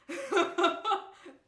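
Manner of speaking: loud burst of laughter. Real, not acted. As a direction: laugh out at her own story. Laughing at herself.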